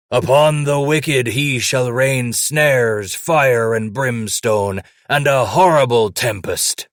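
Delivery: booming scripture, slow and loud; loud bass voice. A narrator's reading. Old trapper booming scripture over a sleeper, loud bass voice. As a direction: thunder the verse like a preacher. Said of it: "spoke in a loud, bass voice".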